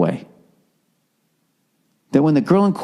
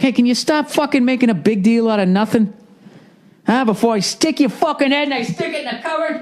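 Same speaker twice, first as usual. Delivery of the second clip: higher-pitched exasperated threat, hammed up; mock outburst building to a high-pitched threat; mock angry scolding; 9 semitones higher than usual. Acts scolding and threatening girlfriend.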